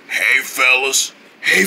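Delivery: normal talking voice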